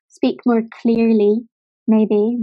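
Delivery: usual speaking voice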